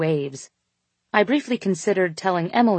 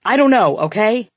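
raised, frustrated shout; 4 semitones higher, 6 dB louder than usual. A narrator's reading. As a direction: blurt it out loud and high. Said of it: "I shouted back".

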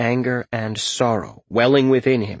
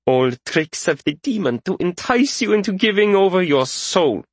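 high-pitched righteous accusation; pitched-up fervent young purifier; zealous younger man; 9 semitones higher than usual. A narrator's reading. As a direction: denounce it as a zealot would. Younger man rejects mage's plea.

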